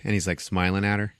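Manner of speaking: normal talking voice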